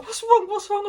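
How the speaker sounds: high, loud worried what's wrong; alarmed. Retold or imitated. Friend Hayley asking in sudden alarm.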